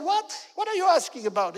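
baffled crowd. Acted. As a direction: toss the question back, bewildered. Crowd baffled by Jesus' question.

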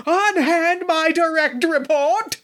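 high, slow, theatrical command; hammy stage actor. Acted. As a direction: declaim it like a melodramatic hero. Character shouts in scene.

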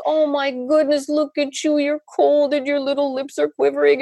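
high, cooing sympathy; fussing tenderly over an infant; empathetic baby talk. Acted. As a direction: mirror a cold baby's distress in a high voice. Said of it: "hears in your voice a perfect representation".

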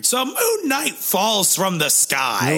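high, slow, nasal-free comic rasp; Gilbert Gottfried impression. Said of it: "I sound like Gordon Godfrey".

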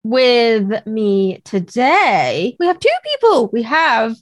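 strained, cracking voice; voice giving out. Real, not acted. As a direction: voice straining and breaking. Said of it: "I keep losing my voice and having coughing fits".

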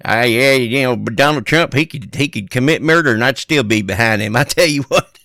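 hillbilly accent. Shown as a demonstration. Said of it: "I'll throw the".

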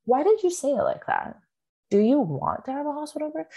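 lightly acted, high-pitched careful questioning; gentle probing. Support-group leader questioning a mother's choice, gentle probing. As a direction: raise the pitch into a tactful, curious query.

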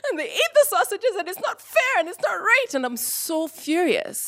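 put-on very high-pitched wail of an outraged child; upset fit of rage. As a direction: protest like a kid in a tantrum, voice way up. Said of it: "in fits of rage and being so upset".

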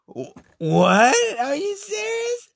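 high, loud squeak of shock; hearing she's like her ex, shocked high squeak; stunned disbelief. Retold or imitated. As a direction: act floored by what she said. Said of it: "And I was like".

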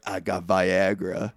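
low slow Joe. Retold or imitated. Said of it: "Joe's like".

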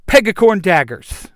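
high, loud battle call; weapon-call yell. A narrator's reading. Yellow Ranger calling up her daggers, high loud yell. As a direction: shout the weapon's name. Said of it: "the Yellow Ranger yells".

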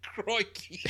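high mock-Aussie exclamation; Australian accent. Host ends the Aussie review, joking Australian accent. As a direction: throw in a hammed-up Aussie catchphrase. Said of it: "so I'm gonna read this one, Australian again".

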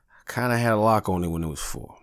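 ordinary delivery

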